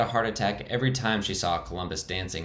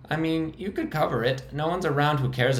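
much higher, squeaking; squeaky high voice; 7 semitones higher than usual. Acted. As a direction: defend the hideout in a high squeak. Frankie's held high voice.

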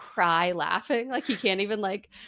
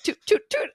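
high squeaky toot noises, much higher; mock toot sounds; 13 semitones higher than usual. Imitating mom's little toots, high mock toots.

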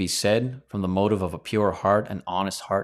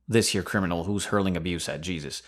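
ordinary talk, nothing marked

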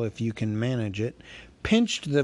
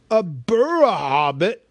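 startled trolls; 6 semitones higher, 7 dB louder than usual. Acted. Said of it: "a bit startled".

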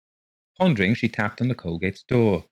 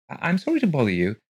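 higher, quick, faltering apology; nervous woman voice; 8 semitones higher, faster than usual. Acted. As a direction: stammer it out nervously.

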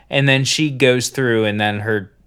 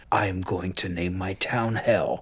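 hushed imitation; 4 semitones lower, 8 dB quieter than usual. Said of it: "She's like".

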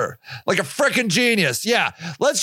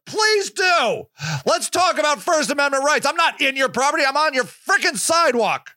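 higher-pitched, indignant dare; defiant retort; 4 semitones higher than usual. Retold or imitated. Activist answering the lady's threat to call police, defiant. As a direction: retell it as a defiant challenge.

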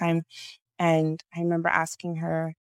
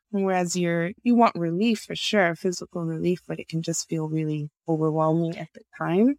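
ordinary delivery

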